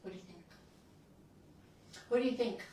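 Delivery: ordinary talk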